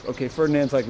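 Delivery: normal talking voice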